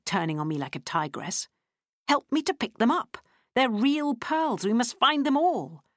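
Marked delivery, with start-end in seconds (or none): 2.1-5.8 frantic. A narrator's reading. Lottie urging the narrator to find her pearls, frantic. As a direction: press for help anxiously.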